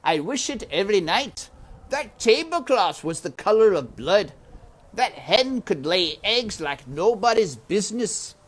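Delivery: loud scornful man's voice; loud mean uncle voice; Uncle sneering. Acted. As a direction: jeer at her cruelly. Uncle regrets the trade.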